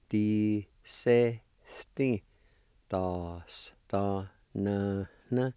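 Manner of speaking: di-se-sti, da-s-, da-na-na stretched out very slowly, syllable by syllable; slow pronunciation demo. Shown as a demonstration. Teacher joining the word parts for students, very slow. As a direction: drag each syllable of di-se-sti out slowly for learners. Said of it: "And together".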